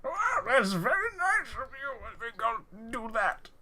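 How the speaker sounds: very high, sing-song put-on voice; Uncle Popius the Pope. Pope accepting the player's offer, high cartoonish role voice. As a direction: pitch way up, swooping like a cartoon character. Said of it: "wait, no, you say that".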